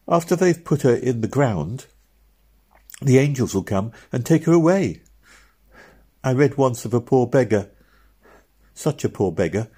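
put-on a small girl working it out aloud; little girl Meg.

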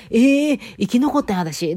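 waking up alive in the dream, a high amazed cry; surprised. Retold or imitated. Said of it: "と思って".

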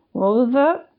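talking through toothpaste; mouth full. Acted. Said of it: "mouth still full of toothpaste".

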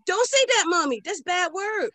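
put-on a little boy correcting his mom; scandalised toddler. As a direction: son scolding mom in a high child voice. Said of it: "I'm like".

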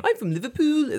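high-pitched, hammed-up Scouse; Liverpool accent. Imitating a friend's English boyfriend, mock Liverpool accent. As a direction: do a bad Liverpool impersonation. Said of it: "Liverpool accent".

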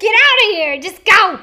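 high, loud shout to leave. Retold or imitated. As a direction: yell them out of the bar. Acted ejecting customers.

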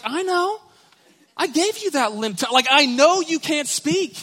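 high, loud exasperated reply; God answering Moses, high and exasperated. Acted. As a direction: throw it back at him.

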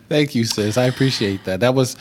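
ordinary talk, nothing marked

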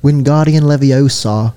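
slow, put-on British spell-casting; British accent. Host quoting Harry Potter for friend, slow British accent. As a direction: recite the spell slowly in mock British. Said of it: "I love Harry Potter".

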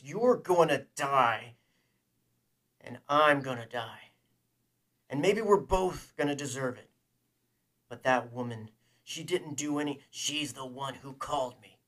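loud, raging outburst; rage. A narrator's reading. Man confronting the killer of a woman, loud rage. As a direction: let the rage build in loud, heavy bursts. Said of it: "hear the rage in his own voice".

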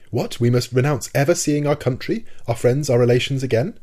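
shocked disbelief. A narrator's reading. Professor hears he may never see his country again, shocked. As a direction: recoil at the thought of never returning. Prisoner shocked at losing homeland.

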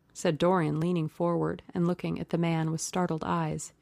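usual speaking voice

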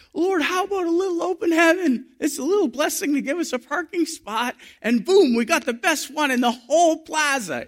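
high-pitched, excited storytelling; giddy retelling. Retold or imitated. Telling Clive about the prayer for parking, giddy and high. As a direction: bubble over with the good news. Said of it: "we were laughing and carrying on".

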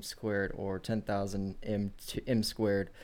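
low, soft, rushed reading; mumbling. Real, not acted. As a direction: mumble quickly through a dry definition. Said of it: "You're mumbling again".

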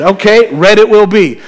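bright, loud prize announcer; cheery game host. Retold or imitated. Prank caller to boy, mock radio host announcing. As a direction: sell it like a radio giveaway host.

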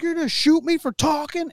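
high, imitated outraged question; retold incredulous protest. Wounded filmer questioning the officer, retold incredulous protest. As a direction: act out his disbelief at the officer. Retold disbelief after being shot.

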